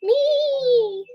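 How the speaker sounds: squeaky high little "Me"; high, chirpy pipsqueak voice; tiny spot voice. Pretend. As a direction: answer like a sore spot raising its hand. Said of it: "that spot that says".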